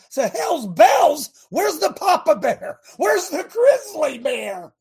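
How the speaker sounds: high, loud, slow calling out; slow, high, loud challenge; rousing preacher shout. Real, not acted. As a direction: roar it at the congregation. Preacher mocking, rising to shout.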